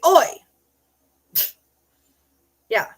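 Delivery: ordinary talk, nothing marked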